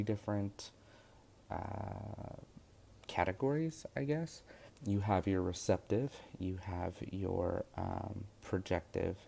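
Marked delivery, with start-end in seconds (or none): none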